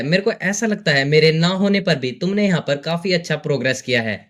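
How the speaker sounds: plain reading voice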